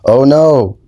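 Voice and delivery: ordinary talk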